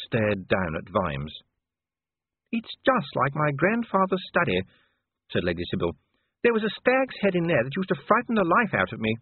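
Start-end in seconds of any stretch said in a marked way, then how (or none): 2.5-9.1 lady's voice. A narrator's reading. Sybil recalling her grandfather's study, high and genteel. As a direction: lift it into a refined lady's voice.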